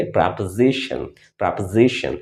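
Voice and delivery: प्रपोजिशन with a short first vowel, said with care; correct pronunciation. Shown as a demonstration. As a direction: model प्रपोजिशन for learners. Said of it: "आप इसे पढ़ेंगे". (प्रपोजिशन का सही उच्चारण, ध्यान से)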